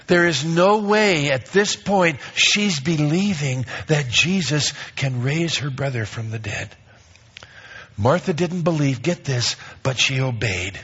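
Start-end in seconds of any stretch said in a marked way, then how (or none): none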